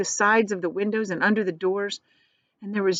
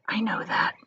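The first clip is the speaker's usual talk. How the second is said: softer unvoiced admission; whispered; 5 dB quieter than usual. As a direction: hush it resignedly. Charlie whispering back.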